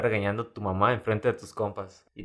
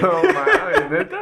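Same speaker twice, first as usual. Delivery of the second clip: high laughing disbelief; 5 dB louder, much slower than usual. Real, not acted. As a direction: laugh out the words. Reacting to the funny story.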